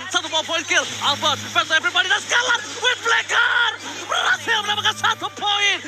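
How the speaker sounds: hyped shout, high pitched; fighting game announcer. Real, not acted. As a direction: call the kill at full hype. Live play-by-play climax.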